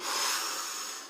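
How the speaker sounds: long, rushing voiceless breath; noisy demonstration breath; deep breath demo. Shown as a demonstration. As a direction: let the air rush in one long whoosh. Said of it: "I go".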